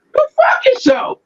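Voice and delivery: sudden high furious shout; angry outburst. Real, not acted. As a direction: spit it out, fed up.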